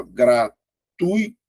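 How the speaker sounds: gratuito slowly split as gra-tui-to; syllable by syllable. Shown as a demonstration. Teacher showing students how gratuito divides, syllable by syllable. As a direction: say "gratuito" in three drawn-out chunks, ui held together. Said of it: "Porque vai ficar assim, ó".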